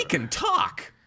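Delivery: high shouted cartoon line; excited shout. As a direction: yell in amazement. Shrek movie quote.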